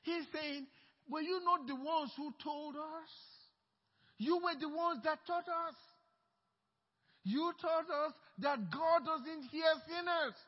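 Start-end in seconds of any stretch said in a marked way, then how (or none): 1.1-10.3 higher, louder, slowing accusation; rising confrontation. Acted. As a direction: throw their teaching back at them. Blind man confronts leaders.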